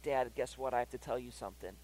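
soft, low imitation of a guilty son; lightly acted reluctant confession; sheepish kid. Son about to confess to dad, sheepishly. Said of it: "he's giving me puppy eyes".

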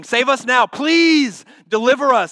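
high, loud desperate plea; pleading crowd. Retold or imitated. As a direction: beg like the crowd. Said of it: "That's what they're saying".

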